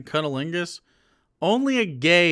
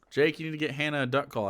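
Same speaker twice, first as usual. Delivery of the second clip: ordinary delivery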